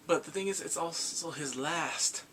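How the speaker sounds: ordinary talk, nothing marked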